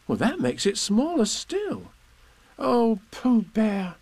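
high, slow lament; dismayed boy. A narrator's reading. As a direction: draw it out in childlike dismay. Boy despairs over tiny boat.

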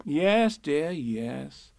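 placating mother voice; soothing. A narrator's reading. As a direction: motherly lilt falling from high. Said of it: "said Kanga soothingly".

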